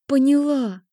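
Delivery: high strained whimper; strained, broken. A narrator's reading. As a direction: force the word out, near tears. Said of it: "выдохнула через силу".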